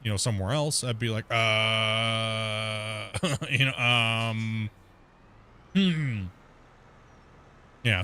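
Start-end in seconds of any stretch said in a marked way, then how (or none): 1.3-6.3 long, drawn-out level "uh", then a laugh; embarrassed word-searching. Acted. Acting out being stuck mid-conversation with a foreigner, hesitant. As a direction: act out stalling for a lost word. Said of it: "I would be embarrassed".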